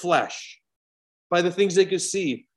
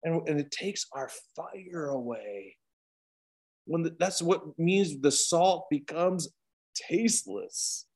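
fading quiet; 7 dB quieter, slower than usual. Acted. Fire taken away, fading.

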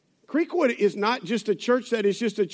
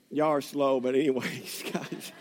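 awkward chuckle, trailing off; nervous laugh; 7 semitones lower, 5 dB quieter than usual. Real, not acted. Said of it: "there's nervous laughter in this place".

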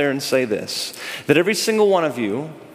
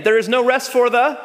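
prompting again; 6 semitones higher than usual. Shown as a demonstration. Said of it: "Let's do that again".

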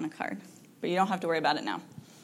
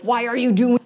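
loud, raised mock-frustrated cry; frustrated outburst; 4 semitones higher, 7 dB louder than usual. Parent frustrated with the child, loud mock outburst. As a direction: blurt it out loud in mock exasperation. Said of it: "she probably already knew I was frustrated".

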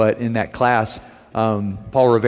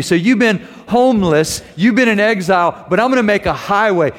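higher, ringing proclamation; impassioned preaching; 6 semitones higher than usual. Acted. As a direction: lift the promise up to the congregation. Voicing God's promise, preaching.

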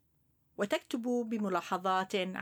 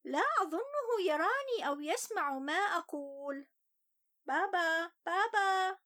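put-on higher young boy's voice, sulky; child voice; 9 semitones higher than usual. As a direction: tell it as an upset little boy. First-person child narrator Ali.

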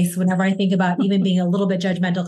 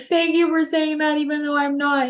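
tearful thanks; 8 semitones higher than usual. Retold or imitated. Retold tearful thanks to stranger.

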